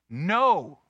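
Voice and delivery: loud retold cry of dismay; re-enacted outcry, one loud word. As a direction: cry out as if at shocking news. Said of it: "And we cried out".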